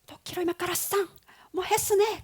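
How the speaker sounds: high-pitched imitation of Japanese; mock Japanese tongues. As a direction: imitate someone bursting into Japanese-sounding tongues. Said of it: "막 일어를 막 하는 거예요". (일본어 방언 흉내)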